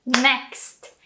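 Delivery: ordinary delivery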